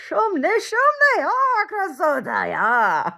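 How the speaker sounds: put-on high, loud, slow old Ukrainian grandma; grandma Rose admiring the dough, in a high babushka voice. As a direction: croon it like a doting babushka over dough. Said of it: "It might be like my grandma Rose".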